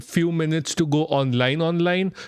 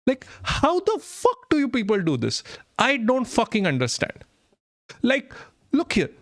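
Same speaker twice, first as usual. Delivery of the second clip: frustrated; 7 semitones higher than usual. Real, not acted. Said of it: "that is absolutely frustrating".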